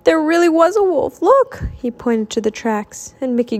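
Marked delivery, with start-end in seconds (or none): 0.0-1.6 high, urgent insistence; worked-up high exclamation; gasped. A narrator's reading. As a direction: insist urgently, jumping higher at the end. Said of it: "he gasped".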